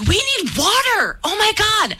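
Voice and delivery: high, exasperated acted cry; desperate outburst, voice shooting up; frantic demand. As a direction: re-enact begging the crew in a panic. Retold desperate demand on plane.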